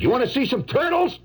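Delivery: high-pitched eager challenge; worked-up offer. Acted. Painter offering his real turtles, high excited challenge. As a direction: pitch it way up, itching to show off.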